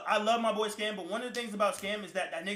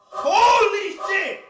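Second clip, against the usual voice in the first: high, loud, slow scream of rage; furious shout; 12 semitones higher, 11 dB louder, much slower than usual. Real, not acted. Reliving the moment of rage, screaming. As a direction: explode at the top of your lungs. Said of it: "I never got so fucking mad".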